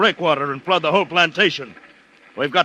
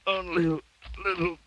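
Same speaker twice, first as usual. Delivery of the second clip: exhausted, fading gasps; 3 semitones higher, 4 dB quieter, slower than usual. Acted. Dying man struggles through ants.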